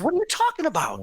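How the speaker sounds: high-pitched retold disbelief; incredulous question. Speaker questions woman who knew about the bridge, incredulous. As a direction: ask it incredulously, pitch well up.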